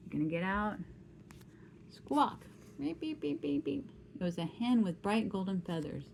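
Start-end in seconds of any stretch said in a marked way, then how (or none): 2.8-3.9 high-pitched chirpy beeps; hen noise. Acted. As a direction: squeak the beeps like a little bird. Hen noise imitated for child.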